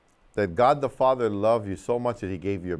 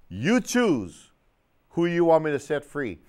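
ordinary talk, nothing marked

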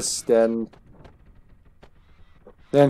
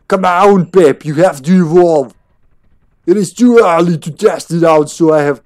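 put-on over-the-top German accent; 3 semitones higher, 6 dB louder than usual. Player trying out scientist voice, hammed-up German accent. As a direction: push the German accent too far. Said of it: "that's not gonna work for her".

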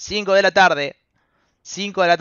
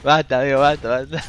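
low plea through laughter; cracking up; 4 semitones lower than usual. Real, not acted. Streamer telling chat enough, cracking up. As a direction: beg them to stop while holding in a laugh. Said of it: "me hicieron tentar".

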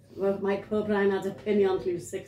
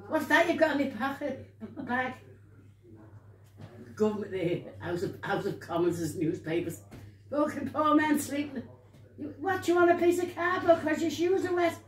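higher-pitched, indignant telling-off; mock scolding; 6 semitones higher than usual. A woman berating the young lad over the cardboard, mock outrage.

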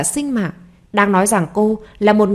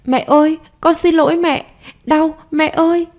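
put-on much higher pleading child's wail; crying child; 7 semitones higher than usual. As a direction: beg mum to stop the pain. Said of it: "Nó phát sợ khóc ầm lên".

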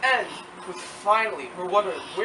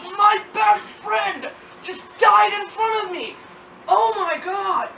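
high, slow hammed-up anguished yell; mock anguished roar; 11 semitones higher, 6 dB louder, slower than usual. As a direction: ham up a grieving wookiee wail. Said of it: "Chewie screamed in horror and rage".

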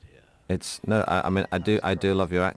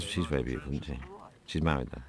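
normal talking voice

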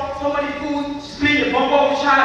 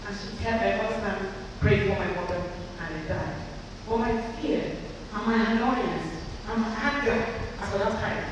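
normal talking voice

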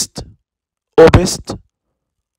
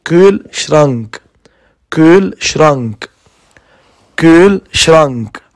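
slow careful repetition. Shown as a demonstration. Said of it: "repeated slowly for pupils".

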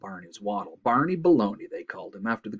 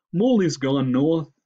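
slow, loud, higher moose voice; congested moose; 6 semitones higher, 7 dB louder than usual. A narrator's reading. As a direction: drag it out through a head cold. Moose character answers.

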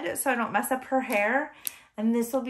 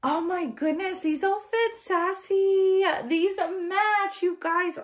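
high-pitched cooing excitement; delighted squeal; 9 semitones higher, 3 dB louder than usual. Real, not acted. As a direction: gush over how cute it is. Excited matching outfits.

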